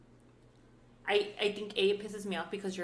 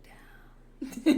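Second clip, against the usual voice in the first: drawn-out whispered reaction; whispered realization; 5 semitones higher, 7 dB louder than usual. Retold or imitated. Looking back an hour later, whispered mock disbelief.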